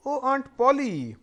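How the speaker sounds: calling loudly for the aunt, drawn out; slow, loud call for Aunt Polly; cried out. Acted. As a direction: shout for help from downstairs. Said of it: "he cried".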